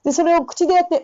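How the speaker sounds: ordinary talk, nothing marked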